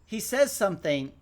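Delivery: ordinary talk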